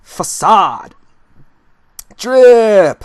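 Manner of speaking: loud playful shout, high on Drip; joking yell. Acted. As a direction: yell it out as a joke. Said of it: "[name] yelled jokingly".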